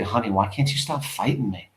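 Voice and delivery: low, gentle appeal; softly. Acted. Wife asking husband to stop fighting, softly. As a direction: ask it low and soft.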